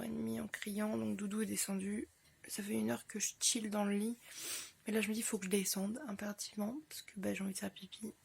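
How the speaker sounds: hushed morning whisper. Real, not acted. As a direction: whisper softly from bed. (en chuchotant)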